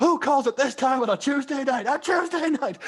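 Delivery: high-pitched mock outrage; imitating a film dad raging at a phone call; really angry. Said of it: "when he gets really angry".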